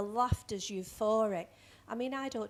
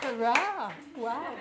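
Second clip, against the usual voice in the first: loud cheer from the crowd; hooray cheer; 4 dB louder, much slower than usual. Real, not acted. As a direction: cheer the act on.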